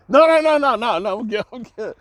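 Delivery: high, loud hurried no-no-no, easing off; startled backpedal, then calming; flustered refusal. Retold or imitated. Wife's startled refusal acted out.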